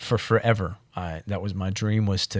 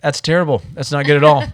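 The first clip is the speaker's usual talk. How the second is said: imitating the pilot, higher and louder; alarmed pilot; 7 semitones higher, 9 dB louder than usual. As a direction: blurt it out like the worried captain. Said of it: "He's like".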